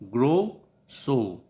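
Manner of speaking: "grow", pause, "so", with both vowels emphasised; stressed rhyme words. Shown as a demonstration. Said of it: "you can see the rhyme also".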